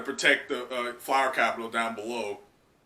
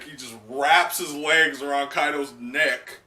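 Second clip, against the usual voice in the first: normal talking voice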